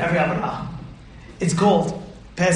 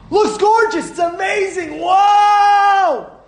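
excited wow; 11 semitones higher, 5 dB louder, much slower than usual. Retold or imitated. Temptation looks amazing.

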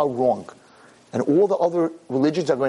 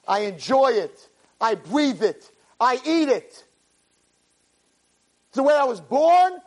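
fiery preaching; 11 semitones higher than usual. Acted. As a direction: preach it with full fire. Rabbi preaches at full force.

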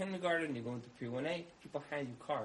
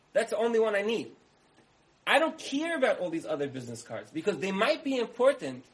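higher and louder, hammering every point; exasperated scolding; 6 semitones higher, 7 dB louder than usual. Acted. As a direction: tell him off, fed up and rushed. Homeowner scolds card-pulling plumber.

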